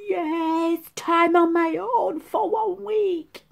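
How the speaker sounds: high, slow gloating cheer; mock glee. Daughter on boyfriend leaving, high mock glee. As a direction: savour the freedom, hammed-up. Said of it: "she was like, going".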